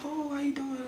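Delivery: put-on sweetly innocent old lady at the door; grandmother greeting. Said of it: "She like".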